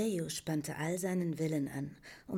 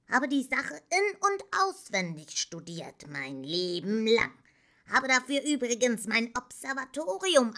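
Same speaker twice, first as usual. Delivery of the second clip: high, animated, boastful character; self-important professor; 6 semitones higher, 8 dB louder than usual. A narrator's reading. Engywuck tells Atreyu of his great scientific work, proud. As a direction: chatter proudly about your life's work.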